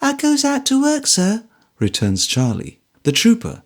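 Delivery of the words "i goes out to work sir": put-on high timid girl voice; young servant girl; girl's voice. A narrator's reading.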